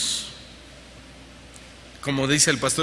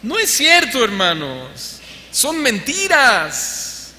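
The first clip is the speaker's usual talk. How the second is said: emphatic denial; 7 semitones higher, much slower than usual. Real, not acted. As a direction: hammer home that it's a lie. Preacher denouncing false claims.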